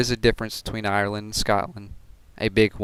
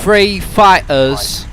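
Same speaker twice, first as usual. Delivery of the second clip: very slow, high, loud British commander; drawn-out mock British battle call; mock British accent; 7 semitones higher, 5 dB louder, much slower than usual. Hammed-up British accent calling to the squadron. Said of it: "How is my British accent".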